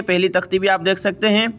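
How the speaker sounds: normal talking voice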